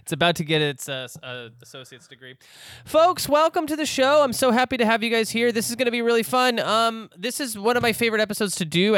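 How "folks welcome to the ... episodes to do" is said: bright, upbeat show opener; host intro voice. Acted. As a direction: welcome listeners like a radio host.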